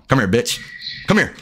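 ordinary delivery

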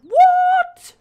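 very high, loud startled shriek; piercing shock at a sudden sighting. Real, not acted. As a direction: shriek it in sheer disbelief.

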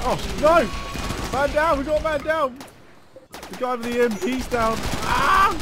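high, loud battle alarm; man down shout. Real, not acted. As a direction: yell it like a panicked squad leader. Soldier falls in firefight.

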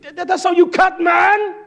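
climbing to a high, loud yell; rallying work shout. Acted. Boss getting on Shimry at work, building rallying shout. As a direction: holler with a laugh, building to full shout. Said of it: "I talk like that".

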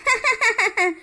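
high, rapid gloating cackle; gloating laugh. Acted. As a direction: laugh in triumph over a beaten rival. Villain gloats after winning quarrel.

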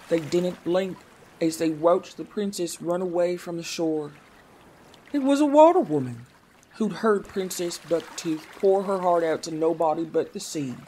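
plain reading voice

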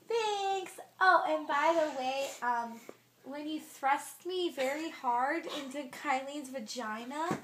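high little character voice; lightly voiced tiny creature; squeaky character voice. Voicing a body part prank.

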